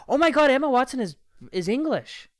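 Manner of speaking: retold stunned realisation; surprised realisation. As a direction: gasp it out like a sudden discovery. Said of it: "I'm like".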